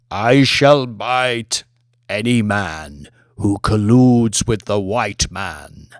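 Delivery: slow, drawn-out serpent threat; deep snake voice. Acted. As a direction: let each word coil out slowly. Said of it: "A deep voice resonated throughout the hall".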